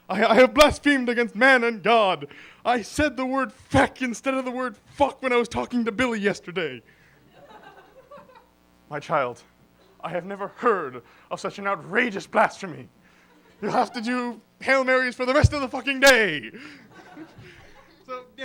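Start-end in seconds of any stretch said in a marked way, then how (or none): 0.1-6.8 loud, stammering, melodramatic confession; anguished penitent. Acted. Sinner confessing a blasphemy to the priest, loud anguished melodrama. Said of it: "go into church the next day and say". 8.9-16.5 grave priest building to a loud verdict; outraged priest. Acted. Priest handing down penance for blasphemy, grave then thundering.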